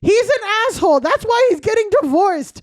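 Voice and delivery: high, loud, blunt sister; sister's blunt voice. Retold or imitated. The sister cutting in on the brother, blunt and loud. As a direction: tell her off straight and loud. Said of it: "She's like".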